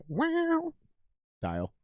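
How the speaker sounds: very high, drawn-out falsetto wow; amazed falsetto wow. Retold or imitated. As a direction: squeal the wow in awe. Mimics amazed reaction to tone.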